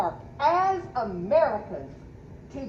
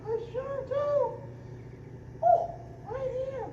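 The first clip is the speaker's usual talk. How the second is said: much higher, squeaky little helper; Sonny voice; 14 semitones higher, 5 dB quieter than usual. Pretend. Sonny answering the guard, high squeaky voice. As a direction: chirp it like an eager toy.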